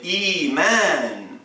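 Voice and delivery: Iman with a long held i; Iman, the i stretched long; long vowel. Shown as a demonstration. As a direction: draw out the i of Iman. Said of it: "comme c'est long, on ajoute une longue voyelle".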